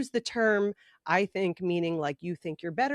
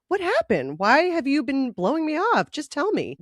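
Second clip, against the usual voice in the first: put-on higher, louder pleading teen; retold upset demand; 7 semitones higher, 5 dB louder than usual. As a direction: press him for the truth, a bit upset. Retold pleading phone call.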